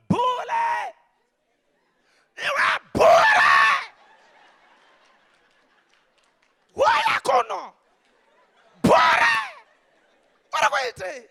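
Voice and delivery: high, loud calling out a name; mother shouting. Retold or imitated. Mother calling her son who won't answer, shouting high. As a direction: yell for the child across the yard. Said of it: "my mother would call me".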